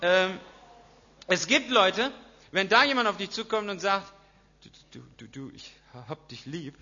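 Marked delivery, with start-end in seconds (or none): 4.6-6.8 much softer, lower, coaxing du du du; tender declaration. Acted. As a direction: murmur it close and lovingly. Someone tenderly saying I love you.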